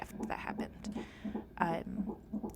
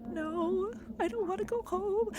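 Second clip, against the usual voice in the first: high, pitched-up no way; incredulous refusal; 13 semitones higher than usual. Retold or imitated. Asked if she wants to go home, she tells the doctor no in incredulous refusal. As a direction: refuse as if the idea is absurd.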